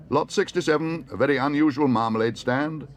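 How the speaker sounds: high, loud auctioneer's announcement. A narrator's reading. Auctioneer announcing the marmalade stand, calling out. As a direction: present the lot to the hall.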